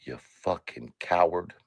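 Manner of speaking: hissed whisper, voiceless and soft. Real, not acted. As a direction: breathe the insult out with menace. Repeats insult in a whisper.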